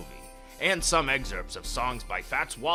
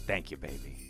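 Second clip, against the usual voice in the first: lower, much softer thanks; tender; 9 semitones lower, 8 dB quieter than usual. Real, not acted. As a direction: murmur it lovingly. Thanks wife softly.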